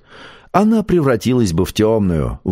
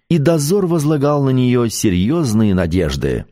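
ordinary delivery, nothing marked

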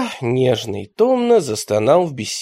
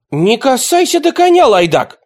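much higher, loud indignant cry; angry shout; 11 semitones higher, 6 dB louder than usual. A narrator's reading. Nedoshkovsky shouting at the boy to leave the horse. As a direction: snap out a furious order. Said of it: "вскричал Недошковский".

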